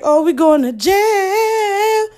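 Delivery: high, slow dread; mock panic. Telling friends in the car, mock panic. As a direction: wail it like doom is certain.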